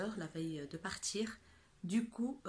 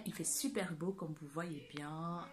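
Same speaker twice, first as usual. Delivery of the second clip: normal talking voice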